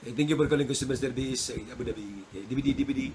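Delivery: quick Indian English call-centre greeting; lightly voiced Indian English phone agent; Indian English accent. Shown as a demonstration. Teacher demos Indian English to students, Indian accent. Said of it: "Indian English".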